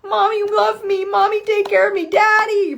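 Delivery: put-on high, tearful child pleading for mommy; needy inner child. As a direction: whimper it like a little kid begging for love. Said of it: "like your mom and your dad".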